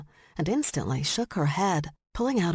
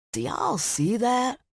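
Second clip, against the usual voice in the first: slow Tennessee drawl, pitched up; 6 semitones higher, slower than usual. A narrator's reading. Smitty watching the honey badger, in a Tennessee drawl. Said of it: "in his Tennessee drawl".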